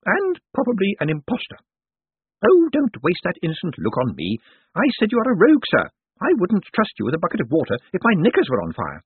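put-on high-pitched scolding old woman; feisty old lady. A narrator's reading. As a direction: scold a rogue with relish as an elderly lady. Old lady keeps accusing Moist.

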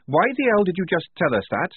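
high, irritated soldier's voice; Tonka. A narrator's reading. Soldier rebuking a worried comrade, high irritated voice. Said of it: "came the voice of Tonka".

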